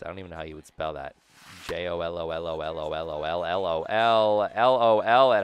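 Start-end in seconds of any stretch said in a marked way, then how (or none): 1.7-5.4 mock spelling. Spelling the silly name.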